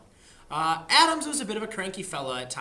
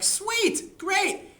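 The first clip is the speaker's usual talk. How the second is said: high, loud mock delight; excited; 14 semitones higher, 5 dB louder than usual. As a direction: chirp it out, much higher and louder. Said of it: "the Americans were like".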